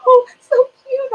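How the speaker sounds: very high, loud, slow squeal of delight; squealing baby talk at a puppy; baby-talk cooing. Retold or imitated. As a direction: melt over the puppy, squealing.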